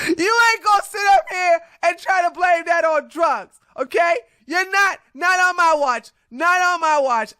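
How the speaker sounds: scolding. Real, not acted. Scolding commenter.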